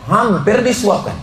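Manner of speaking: ordinary talk, nothing marked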